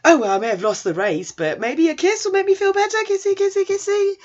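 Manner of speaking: flirty teasing. Retold or imitated. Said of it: "he's like".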